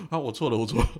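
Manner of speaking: loud apology broken by laughter; laughing apology. Retold or imitated. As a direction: give in to mom, laughing.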